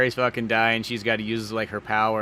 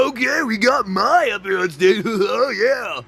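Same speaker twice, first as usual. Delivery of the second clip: loud, high club announcer hype; DJ guy; 10 semitones higher, 10 dB louder, slower than usual. Retold or imitated. DJ announcing Mai up on stage, hyped. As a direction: whoop it up like a stage DJ. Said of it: "that D J guy who's like".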